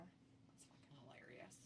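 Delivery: usual speaking voice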